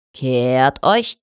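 low, firm military order; drill command. A narrator's reading. Officer to drilling stallions, firm low-pitched command. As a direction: give the about-turn like a drill officer.

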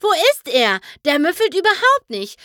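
high, loud and eager; bouncing with excitement; excited calling. A narrator's reading. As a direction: call out as if he could be hiding here. Pinkie searching for her friend.

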